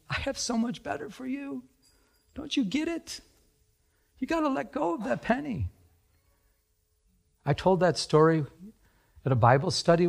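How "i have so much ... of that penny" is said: high, soft, tender plea; acted patient, hushed appeal; gentle urging. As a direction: coax softly, pitched well up. God gently urging in retelling.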